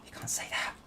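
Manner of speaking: whispered in mock horror; hushed gasp of fake outrage; mock-shocked whisper. Real, not acted. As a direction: breathe it like a scandalised aside.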